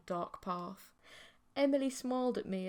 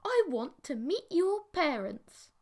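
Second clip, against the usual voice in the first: slow, higher bright declaration; announcing; 6 semitones higher, 3 dB louder than usual. Acted. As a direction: announce it like a decision already made. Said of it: "she announced".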